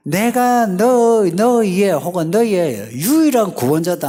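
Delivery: high, loud, emphatic proclamation; God's insistent declaration. Acted. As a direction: declare it as an exasperated God. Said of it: "그 반응이 이런 거예요".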